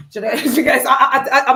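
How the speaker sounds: loud laugh through the words; cracking up mid-sentence; laughing loudly. Real, not acted. Laughing at her own boast.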